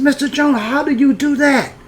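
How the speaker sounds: high-pitched imitated boyish wonder; amazed. Boy seeing tap water first time, amazed. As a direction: ask like an awestruck boy, pitched right up.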